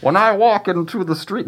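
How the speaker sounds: a squeaky mock Bane line; Bane impression. Friend requests Bane accent, he does a squeaky mock Bane. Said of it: "Can you do it in the accent?".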